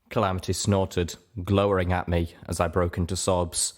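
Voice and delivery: ordinary delivery, nothing marked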